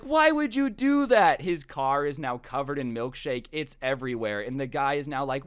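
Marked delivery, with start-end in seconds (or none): 0.0-1.4 high, loud, slow outraged cry; retold yell of shocked outrage. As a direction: cry out in disbelief at being splashed. Driver hit by milkshake, shocked.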